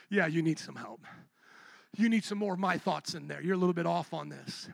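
God. Retold or imitated. God remarking on our thinking.

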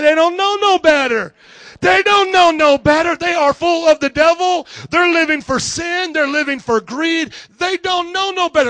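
high, loud, emphatic proclamation; full-force congregation rouser; rousing preacher. Acted.

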